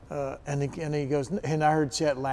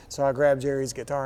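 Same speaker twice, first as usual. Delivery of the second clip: usual speaking voice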